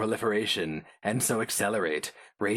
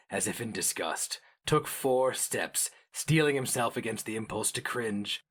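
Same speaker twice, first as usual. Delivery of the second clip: usual speaking voice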